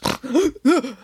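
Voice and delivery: ugly waking snort; grunting snort. A narrator's reading. Santa suddenly waking on the couch, grunting snort. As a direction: snort and grunt yourself awake. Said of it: "woke up with a grunt and a very unattractive snort".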